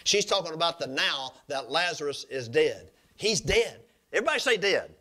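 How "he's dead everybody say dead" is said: emphatic preaching. Acted. Preacher stressing dead.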